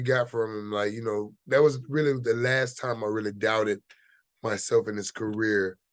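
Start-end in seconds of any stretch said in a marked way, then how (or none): none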